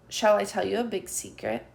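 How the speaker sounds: ordinary delivery, nothing marked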